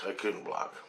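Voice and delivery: soft repeated admission; defeated echo. Real, not acted. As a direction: let it fade out, beaten. Repeats the line, second time fading.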